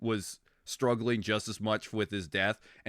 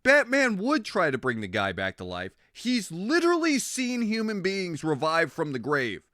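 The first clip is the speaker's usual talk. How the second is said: exasperated ranting; 6 semitones higher than usual. Retold or imitated. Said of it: "I'm just like".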